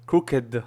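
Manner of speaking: "crooked" drawn slowly with a heavy voiced D released at the end; overdone final D. Shown as a demonstration. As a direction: stress the final "duh" in "crooked" as the wrong example. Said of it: "You don't do that, right?".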